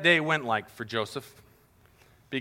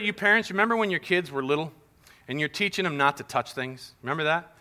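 usual speaking voice

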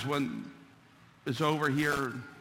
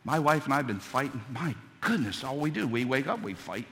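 ordinary delivery

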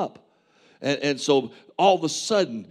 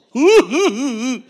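high burst of laughter; 10 semitones higher, 9 dB louder than usual. Real, not acted. Laughing at his own crying story.

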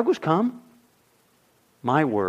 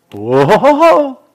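big startled exclamation; loud whoa; 7 semitones higher, 13 dB louder than usual. Real, not acted. Preacher exclaims at the verse's first words. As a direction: shout it out in surprise.

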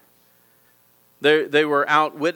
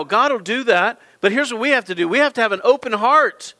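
ordinary talk, nothing marked

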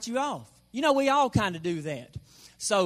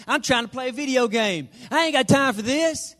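raised, louder indignant brush-off; mock exasperation; 5 semitones higher, 5 dB louder than usual. Debtor busy with a video game, mock-indignant protest to mortgage company. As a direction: protest like an interrupted gamer. Acted brush-off to a debt collector.

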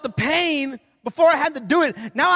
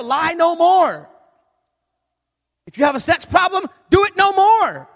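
rising, louder pulpit command; preaching; 7 semitones higher, 5 dB louder than usual. Acted. Preacher commanding the congregation, forceful preaching. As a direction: preach it, slow and building.

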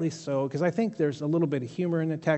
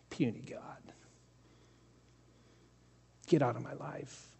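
hushed, softer, whispered rebuke; 4 semitones lower, 4 dB quieter than usual. Acted. Believer dismissing false gods from their life, hushed whisper. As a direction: speak it low and intimate.

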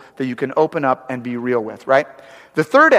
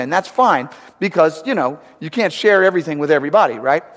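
normal talking voice

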